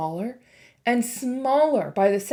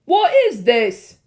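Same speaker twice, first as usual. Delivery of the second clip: slow, louder uneasy question; a touch of a wary kid; uneasy boy; 9 dB louder, slower than usual. A narrator's reading.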